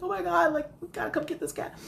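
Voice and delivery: high-pitched, hurried alarm; frantic. Retold or imitated. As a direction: plead for help in a panic. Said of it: "And I am frantic".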